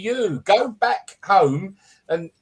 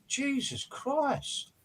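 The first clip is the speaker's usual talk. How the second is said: exasperated; 8 semitones higher, 10 dB quieter, much slower than usual. Real, not acted. Exasperated groan.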